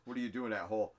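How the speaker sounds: ordinary talk, nothing marked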